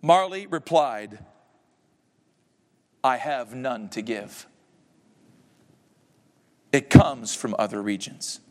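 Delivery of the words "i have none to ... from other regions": cold ghost reply. Acted. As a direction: deliver it hollow and final. Ghost answers coldly.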